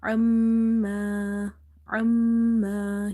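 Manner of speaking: عَمَّا with a long nasal hum on the m; sustained nasal "mm" in عَمَّا; ghunna. Shown as a demonstration. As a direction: hold and hum the doubled meem in عَمَّا. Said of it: "You have to do gunna here".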